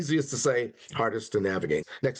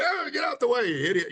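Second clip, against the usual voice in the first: impatient driver; 10 semitones higher than usual. Retold or imitated. Rushing driver yelling at the car in front, mock irritation. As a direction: playfully fume at the driver ahead.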